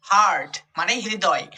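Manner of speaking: usual speaking voice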